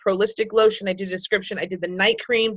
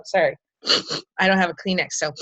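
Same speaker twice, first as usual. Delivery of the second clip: ordinary talk, nothing marked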